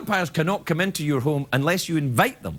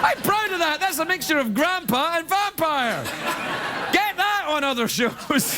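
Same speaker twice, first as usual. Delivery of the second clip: smug pride; 11 semitones higher than usual. Real, not acted. Pleased with his grampires joke.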